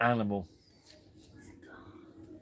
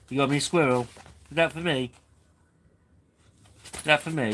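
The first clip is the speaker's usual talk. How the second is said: higher, louder lilting questions; playful pet talk; 4 semitones higher, 3 dB louder than usual. Real, not acted. Asking Bella about the squirrel, playful pet talk. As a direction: coax the pet with eager little questions.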